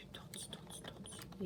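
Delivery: whispered calling, hunting for the turtle; whispering. Pretend. Colourist searching her books, whispering for her turtle. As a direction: breathe the name as if calling a pet.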